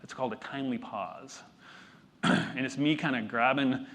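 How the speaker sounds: ordinary talk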